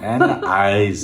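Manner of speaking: slow, heavy Austrian "Ana eyes"; Arnold accent. Shown as a demonstration. Learner repeats the Arnold accent. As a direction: say it like the Terminator.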